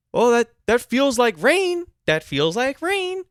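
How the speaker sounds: high, loud mock manager with snooty control; bitchy, controlling. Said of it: "in a very bitchy, very bitchy, controlling, controlling tone".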